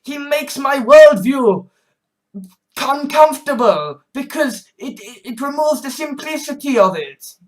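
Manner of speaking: high dramatic mock outrage; mock zealot. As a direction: ham up a hater demanding destruction. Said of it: "just like".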